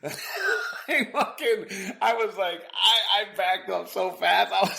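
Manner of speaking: high laughing voice; laughing while telling. Real, not acted. Recalling the shock, laughing while speaking. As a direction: tell it through laughter. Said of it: "I was laughing".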